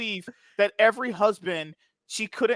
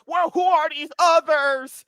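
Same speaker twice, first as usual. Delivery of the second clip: slow mock quote; 6 semitones higher, 4 dB louder, slower than usual. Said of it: "he was like".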